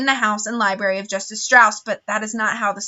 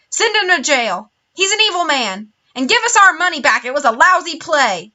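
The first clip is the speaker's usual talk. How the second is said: higher, louder angry jeers; crowd shouting; 5 semitones higher, 4 dB louder than usual. A narrator's reading. Angry theatre audience jeers at Olaf, loud heckling. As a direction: heckle from the seats.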